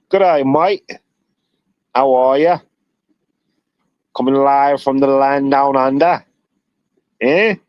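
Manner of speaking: amateur Australian accent, announcing yourself live from down under. Shown as a demonstration. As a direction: attempt an Aussie accent, drawn out. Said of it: "trying to practice my Australian accent".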